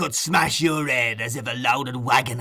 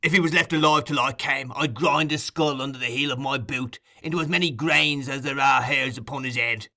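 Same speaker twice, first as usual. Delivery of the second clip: usual speaking voice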